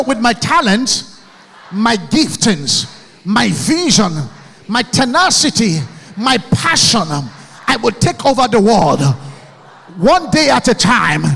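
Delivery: loud chant-like vow for the crowd to repeat; shouted affirmation. Shown as a demonstration. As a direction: lead the hall in a rallying vow.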